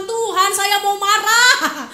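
laughing mock prayer. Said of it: "kita minta sama Tuhan".

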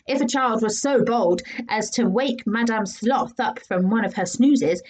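usual speaking voice

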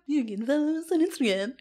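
a light mock of a cheesy promo voice; mocking imitation. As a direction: parrot her silly plug voice back at her. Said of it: "Is that what I sound like to you?".

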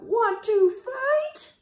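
high, loud, slow taunt; big drawn-out challenge. A narrator's reading. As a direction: yell up at the rhinoceros. Ladybug challenges rhinoceros.

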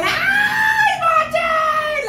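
high piercing fangirl scream; real thrilled scream; excited shriek. Real, not acted. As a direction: shriek his name in delight. Fangirl scream at drama scene.

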